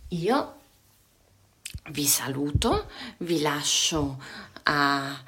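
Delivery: soft whispered goodbye; hushed tender goodbye. Real, not acted. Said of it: "whispered goodbye".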